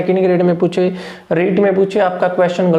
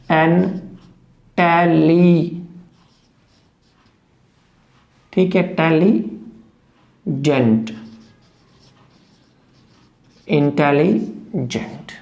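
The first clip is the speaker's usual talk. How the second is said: slow syllable breakdown; 3 semitones lower, much slower than usual. Shown as a demonstration. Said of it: "देखिए आई".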